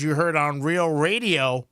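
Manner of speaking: usual speaking voice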